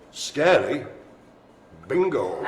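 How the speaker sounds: slow title with a suspenseful pause; mock-ominous reveal, word by word; spooky announcer. Game launched with mock-spooky title.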